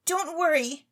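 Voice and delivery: put-on high, eager young lady pleading; a rich, silly girl begging to go on stage; Claribel's voice. Claribel begs the chemist for stage talent, high and eager.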